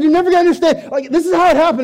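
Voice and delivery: high, loud over-excited pleading; excited insistence. Retold or imitated. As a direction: insist he hear the whole story. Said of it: "And I said".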